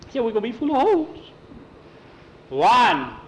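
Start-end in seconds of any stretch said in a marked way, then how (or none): 0.2-1.2 put-on high-pitched frightened boy voice; panicked squeak. A narrator's reading. Skeeter fears being shot in the closet, panicked squeak. 2.5-3.1 threatening count. A narrator's reading. Counting down to firing.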